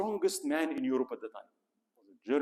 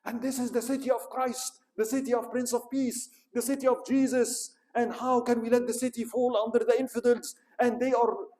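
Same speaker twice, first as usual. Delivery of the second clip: higher, louder, grand pleading; lofty papal plea; 9 semitones higher, 5 dB louder than usual. Retold or imitated. Pope appealing to the kings' devotion, in a high ringing voice. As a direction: lift the voice to the heavens.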